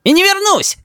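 very high, defiant yell; high-pitched childish defiance; child shouting. A narrator's reading. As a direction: shout back like a stubborn little boy. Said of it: "кричит Рис".

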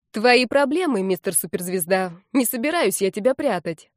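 ordinary delivery

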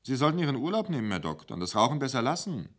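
a kindly waitress fussing over him; motherly waitress. Acted. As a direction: sorgende Kellnerin, höher und schnell. Wulla voiced by narrator.

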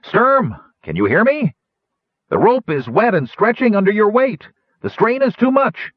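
high, loud gnome cry. A narrator's reading. Unseen gnome warning Sturm on the rope, high loud cry. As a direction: yell it down from the airship, high and loud. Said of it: "cried the unseen gnome".

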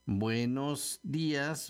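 slow Buenos días, leaning on BUE and DÍ; exaggerated stressed syllables. Shown as a demonstration. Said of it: "con acento en la E de buenos".

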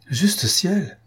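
startled outcry at moderate volume; shocked exclamation. A narrator's reading. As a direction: exclaim it high, as if stung. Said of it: "s'écria Charlotte en se levant".

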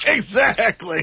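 high, slow agreeing cabbie; drawn-out cabbie agreement; Crazy Cabbie. Acted. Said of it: "his new Crazy Cabbie impression".

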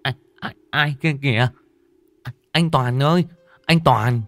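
high, loud panicked stammer; stammering terror. Acted. Terrified friend stammering to brother about ghost. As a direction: stutter it out in terror. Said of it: "miệng lắp bắp".

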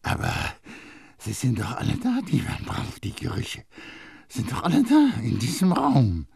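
pressed, partly breathy youth voice; Grenouille insisting. A narrator's reading. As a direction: point them out one by one. Grenouille points out scents.

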